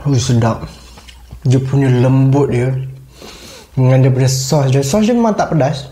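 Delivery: talking between bites; speaking while eating; mouth full, chewing. Real, not acted. Seen 1: Bite taken at -9; closed, working mouth between words while speaking.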